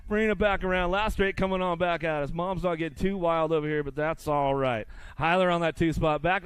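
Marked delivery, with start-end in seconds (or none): none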